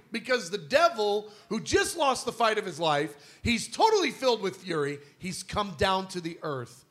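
ordinary talk, nothing marked

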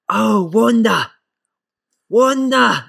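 loud, high, drawn-out call across the car park; calling out Wanda. Retold or imitated. Coach calling a youth player from the gym window, loud and stretched. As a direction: sing out the name across a yard.

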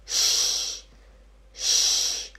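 "sh" as a drawn-out hushing sound; long sh. Shown as a demonstration. Teacher showing how SCH sounds, long "sh". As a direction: hold a voiceless "sh" alone. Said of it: "faz som de".

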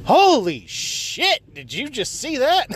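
slow, high disbelief; drawn out in shock; shocked at a driver's move on the road; stunned. Real, not acted. Reacting to something seen driving.

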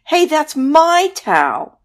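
high, loud, slow protest; hammed-up towel fight; indignant monster. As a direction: shout it like a squabbling creature.

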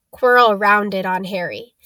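plain reading voice

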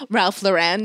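"Lauren" with the weight on the last syllable, lo-REN; "Ralph Lauren" as Ralph lo-REN; mispronounced Ralph Lauren. Retold or imitated. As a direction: say "Ralph Lauren" as if French, ending in a full REN. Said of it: "her biggest pet peeve is when someone goes".

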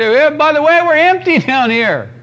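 high, loud comic complaint of an empty belly; indignant mock holler; yelling stomach. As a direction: yell up for food in a hammed-up voice. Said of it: "it's gonna start yelling for some food".

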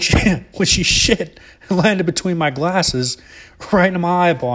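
high, slow indignant explanation; aggrieved dad. Retold or imitated. Dad explains to laughing son, slow indignant whine. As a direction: spell out the outrage slowly like a wronged dad. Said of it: "He's like".

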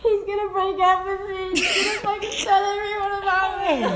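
high, loud teary wail, half laughing; mock crying. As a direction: play your panicked fourteen-year-old self sobbing it out. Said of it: "I was fucking crying".

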